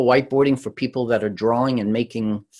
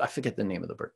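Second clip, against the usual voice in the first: ordinary delivery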